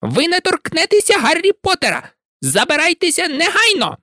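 very high, stern elf voice; angry house-elf. A narrator's reading. Dobby ordering Malfoy away, angry. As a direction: command him off fiercely. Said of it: "гнівно звелів він".

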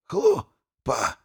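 high, strained gasp of a word; squeezed out. A narrator's reading. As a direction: force it out through pain. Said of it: "выдавил архимаг".

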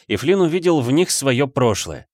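ordinary delivery, nothing marked

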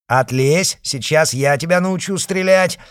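put-on loud, high-pitched drunken threat; angry threat. Drunk Batenkov threatening a fellow officer, loud and angry. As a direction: bark it at him, waving the pistol.

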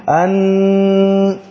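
"أن" with the doubled nun held for two beats; two-beat hold. Shown as a demonstration. Teacher isolating the elongated nun. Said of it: "مديت حركتين".

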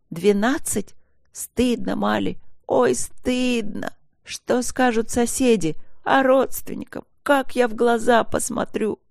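wailing reproach. A narrator's reading. Wife shames her husband before neighbours and relatives, wailing. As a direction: lament the shame, tearfully scolding. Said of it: "Она зарыдала ещё громче".

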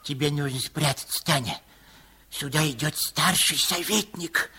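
soft, fast, low urgent aside; hurried aside. Acted. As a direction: hush her into hiding, fast and low. Hurried aside to hide Tanya.

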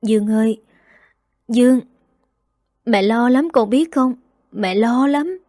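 mother's choked cry over her son; choked with tears. A narrator's reading. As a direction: plead through a lump in the throat. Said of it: "nghẹn ngào nói".

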